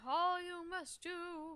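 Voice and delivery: slow, drawn-out dying ghost; milk every word like a dying spirit; overacted death scene. Ghost continues the prophecy.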